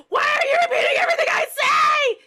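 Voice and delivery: very high, loud tearful shriek; frantic, full-blast yell; hysterical scream. Acted. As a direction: scream in exasperation at being echoed. Mother screaming at husband.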